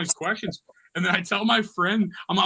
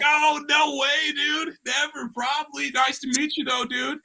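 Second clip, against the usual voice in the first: hyped-up refusal; 9 semitones higher, slower than usual. Retold or imitated. Teasing a guy who wants a ride, in a high, hyped mock voice. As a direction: pump the guy up, then let him down slow.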